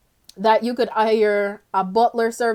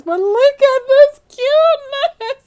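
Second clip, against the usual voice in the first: much higher and louder, slow cooing squeal; gushing over cuteness; 14 semitones higher, 8 dB louder, slower than usual. Real, not acted. To the viewers about the cute baby, squealing cutely.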